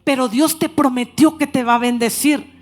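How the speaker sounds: loud, slow, emphatic preaching; preaching at full force. Acted. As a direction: proclaim the promise to the church, loud. Preaching God's promise. (predicación enérgica y fuerte, proclamando la promesa)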